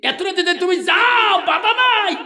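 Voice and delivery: acted shout after someone. Husband shouts to wife leaving at night, high loud call. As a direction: shout it out with long-held endings.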